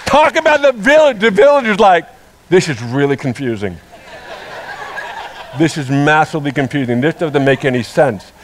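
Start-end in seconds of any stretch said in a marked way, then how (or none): 2.5-8.3 lower, softer mock mumble of confused villagers; bewildered villagers. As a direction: murmur it like puzzled onlookers.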